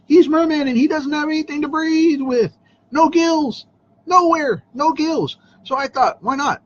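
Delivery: high-pitched mock disbelief; incredulous. Real, not acted. Mock outrage about no gills.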